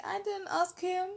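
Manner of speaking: mild indignant thought; indignant inner voice. Retold or imitated. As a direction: argue it in your head. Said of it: "In my mind, I'm like".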